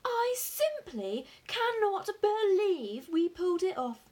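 giddy, emphatic amazement; elated disbelief. A narrator's reading. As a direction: marvel out loud at pulling it off. Father elated after the haul.

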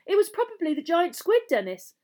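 high, loud, eager schoolboy; excited. A narrator's reading. Brother explains the lake to the younger boy, excited. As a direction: burst out with it excitedly. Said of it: "said Colin just as excitedly".

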